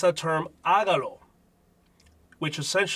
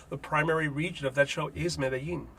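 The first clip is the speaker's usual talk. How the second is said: usual speaking voice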